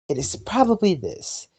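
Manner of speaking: high-pitched female impression with a slight accent; girl voice. Shown as a demonstration. Repeating the demo for a friend, high girl voice. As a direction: lift the pitch into a girl's voice. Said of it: "closest I can get to a girl voice".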